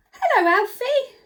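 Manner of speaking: high gasped greeting; delighted gasp. Real, not acted. Greeting puppet in surprise.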